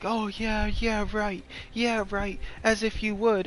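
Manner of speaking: high-pitched mock disbelief; hammed-up incredulous "yeah, right"; baffled scoff. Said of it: "the lady looks all baffled".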